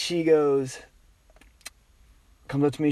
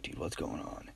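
whispered worry; 13 semitones lower, 23 dB quieter than usual. Retold or imitated. Whispered aside to himself in class.

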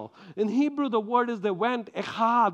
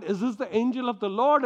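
normal talking voice

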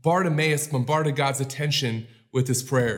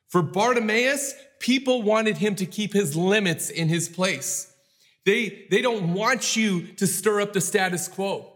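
raised, rising sermon voice; preaching; 4 semitones higher than usual. Acted. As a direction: preach it higher and build. Preacher builds to full force.

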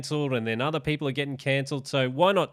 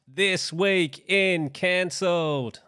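announcer voice; 6 semitones higher, much slower than usual. Shown as a demonstration. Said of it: "would sound so much better with a professional voiceover".

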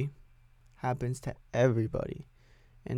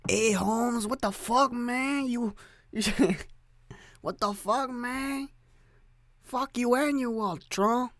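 much higher, hammed-up homie accent; mock street accent, laughing through it; put-on street accent; 11 semitones higher than usual. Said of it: "I don't know what accents I can do".

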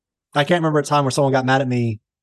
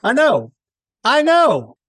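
excited; 9 semitones higher, 3 dB louder, much slower than usual. Real, not acted. Excited agreement.

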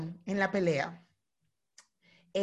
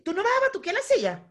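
high-pitched, incredulous mock challenger; provoking opponent; 8 semitones higher, faster than usual. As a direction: imitate someone egging on a fight. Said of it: "La otra persona te va a decir".

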